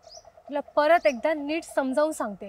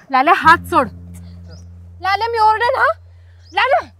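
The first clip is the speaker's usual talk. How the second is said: louder and higher, struggling to get her hand free; shouting for help; 4 semitones higher, 5 dB louder than usual. Acted. Girl shouting at the thug who grabs her hand. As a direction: yell his name to break free.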